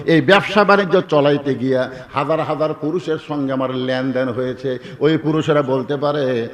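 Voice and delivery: usual speaking voice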